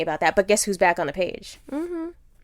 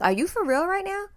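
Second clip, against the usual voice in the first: mocking higher incredulous voice; imitating Justin; 10 semitones higher than usual. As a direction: mimic the boyfriend's disbelief. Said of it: "was like".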